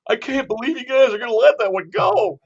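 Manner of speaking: teasing a buddy with an imitation of his pitchy complaint; exasperated outburst. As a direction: squeal your outrage at passing the deer. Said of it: "You said it more".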